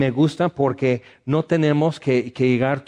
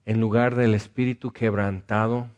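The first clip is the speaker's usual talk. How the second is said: earnest pastoral prayer. Acted. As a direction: pray gently over the sad. Leads prayer for the sad.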